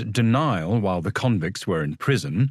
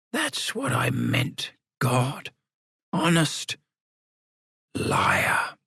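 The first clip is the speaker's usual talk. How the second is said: lightly voiced old man, slow; a hint of an old codger; 3 semitones higher, much slower than usual. A narrator's reading. Sam's old voice answering.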